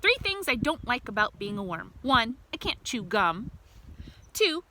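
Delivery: usual speaking voice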